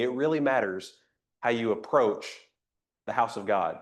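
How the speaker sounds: ordinary talk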